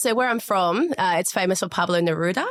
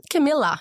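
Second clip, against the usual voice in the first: Camilla said clearly, high and stressed; carefully pronounced brand name; spelling out a name; 8 semitones higher than usual. Shown as a demonstration. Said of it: "C A M I double L A".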